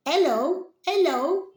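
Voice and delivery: high, loud little frog greeting; cartoon frog saying hello; squeaky frog voice. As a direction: play the toy frog calling hello. Frog toy says hello.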